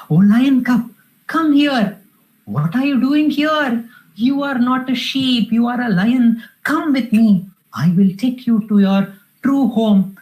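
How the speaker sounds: storyteller's surprised lion, lightly acted. Surprised lion calls the cub among the sheep, mildly astonished and urging. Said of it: "this lion is surprised".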